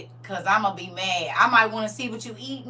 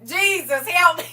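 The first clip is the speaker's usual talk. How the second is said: high, loud disgusted ooh; exclaiming; 9 semitones higher, 11 dB louder, much slower than usual. Real, not acted. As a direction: cry out in disgust to Jesus. Disgust at smacking sounds.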